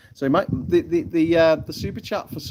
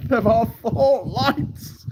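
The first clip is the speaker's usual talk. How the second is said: high, loud, drawn-out declaration; mock defiant cry; 13 semitones higher, 5 dB louder, much slower than usual. Streamer quotes a famous line to viewers, mock defiant cry. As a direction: cry it out slowly, high and dramatic.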